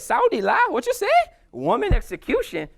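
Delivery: mock-offended disbelief; indignant disbelief. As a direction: scoff at the insult. She rejects a woman's execution.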